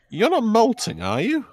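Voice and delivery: high, loud, swooping wizard voice; suspicious wizard. Acted. Wizard pressing fox customer on shedding, incredulous.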